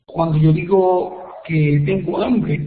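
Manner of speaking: normal talking voice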